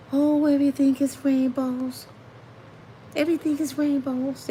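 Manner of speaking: ghostly Joel Osteen. Retold or imitated. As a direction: float the words like a dreamy ghost. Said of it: "don't he talk like a ghost to y'all".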